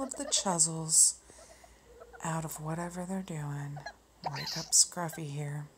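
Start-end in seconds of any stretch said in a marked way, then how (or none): none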